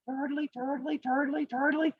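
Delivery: small high chant; plodding turtle. Acted. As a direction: chant softly and high in an even rhythm. Turtle still running in story.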